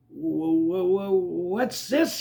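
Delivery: high, slow, stuttering question; drawn-out uneasy wondering; alarmed stammer. Acted. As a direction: stammer warily at something slimy. Character touches something slimy, wonders.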